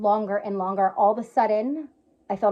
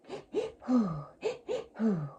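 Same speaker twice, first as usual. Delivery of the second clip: two quick inhales, one long exhale; breathwork breathing pattern; 6 dB quieter than usual. Shown as a demonstration. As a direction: demonstrate breathwork with sharp sniffs and a sighing out-breath. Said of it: "it's like a".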